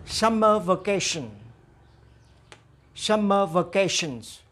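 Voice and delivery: slow, careful phrase for students to echo; slow model for repeating. Shown as a demonstration. Teacher drilling vocabulary for the class to repeat. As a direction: say each phrase slowly, twice, to be copied. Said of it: "Now repeat after me".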